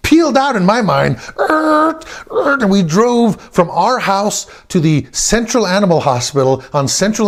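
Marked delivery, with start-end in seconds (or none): 1.4-2.6 high screeching tire noise; imitated tire squeal. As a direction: squeal like peeling-out tires. Imitates tires as car peels out.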